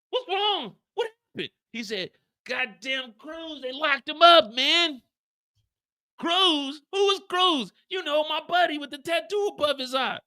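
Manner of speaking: voiced scene, high. Speaker acting Mireya and Miguel in a high voice. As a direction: play both siblings up high.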